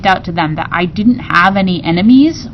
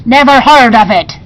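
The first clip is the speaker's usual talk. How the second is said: gruff pirates; 4 semitones higher, 6 dB louder than usual. A narrator's reading. Pirates answer.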